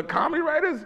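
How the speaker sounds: high, loud words squeezed out through laughter; cracking up mid-sentence; laughing through words. Real, not acted. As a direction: barely get it out for laughing. Laughing while retelling the sketch.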